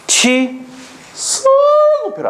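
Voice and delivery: drawn-out, high mnemonic chant; syllables pulled apart to stick in memory; memory-aid stretch. Shown as a demonstration. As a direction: stretch 취소급표 out slowly, holding 소 long and high. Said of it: "이게 외울 때 쉽게".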